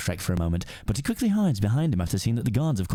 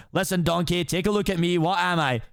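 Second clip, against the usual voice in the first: put-on Scottish accent; 7 semitones higher than usual. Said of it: "aggressive Scottish accent".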